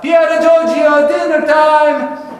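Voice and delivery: high, loud mock-Italian mama calling out; terrible Italian accent. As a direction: holler it in a hammed-up Italian accent. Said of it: "here's my terrible Italian accent".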